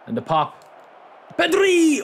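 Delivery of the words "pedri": sudden high, loud yell of the name; excited shout. Real, not acted. Gamer shouting at his striker during an attack, excited yell. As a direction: jump out of your seat calling the player. Live chance in football game.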